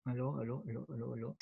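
faint, distant phone voice; calling hello from far off; phone held away. Real, not acted. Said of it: "Está un poco bajito".

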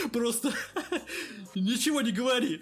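words squeezed out through laughter; laughing hard. Acted. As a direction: barely speak for laughing. Captain laughing hysterically.

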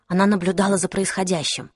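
plain reading voice

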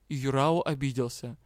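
ordinary delivery, nothing marked

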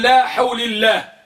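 usual speaking voice

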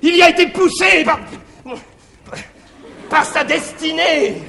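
loud pleading excuse; loud protest. Acted. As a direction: throw up your hands and plead it out loud. Scapin excuses the son loudly.

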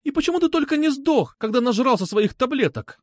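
high-pitched, exasperated tirade; angry scolding. A narrator's reading. Girl berating her unwanted suitor, angry scolding.

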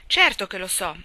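father irritated at his son's question; rather irritated. A narrator's reading. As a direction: snap it back, annoyed. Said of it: "piuttosto irritato".

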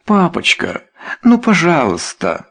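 high, pleading daughter's voice; plaintive plea. A narrator's reading. Daughter Esther begging her father, plaintive. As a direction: beg Papa plaintively. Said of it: "жалобными просьбами".

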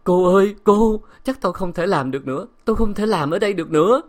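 high, loud weeping plea; distraught servant's loud lament; tearful wailing. A narrator's reading. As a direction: cry out in distress to her mistress. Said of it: "tiếng khóc của bà Ngô".

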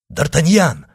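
answering cry of recognition; exclaiming. Acted. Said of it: "вскричал Атос".

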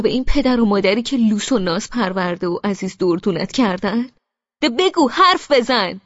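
loud, high, mocking interrogation; furious shout. A narrator's reading. Brother shouting mocking questions at his silent sister. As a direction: hammer each question at her. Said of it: "پرخاش کنان و با شدتی بیشتر رو به من فریاد زد".

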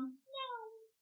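high squeaky meow; a kitten's little meow; tiny mewing kitten voice; cat meow. Acted. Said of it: "кто-то сказал".

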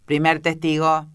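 loud herald's call; shouted summons. A narrator's reading. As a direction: call the witness out across the court. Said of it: "después de lo cual gritó".

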